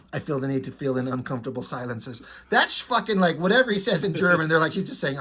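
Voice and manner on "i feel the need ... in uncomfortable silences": softer, lower, fast subtitle read; deadpan subtitle read. Retold or imitated. Reading Wolverine's subtitle, soft quick deadpan. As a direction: rattle off the translation flatly.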